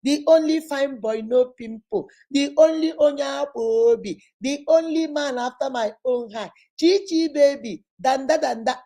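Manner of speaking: put-on butter up the boyfriend with pet names; gushing praise. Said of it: "they will praise you".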